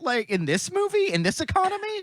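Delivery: high, climbing disbelief; joke-protest with the voice shooting up; playfully scandalised, high-pitched questioning; mock incredulity. Mock-arguing back at complaining fans.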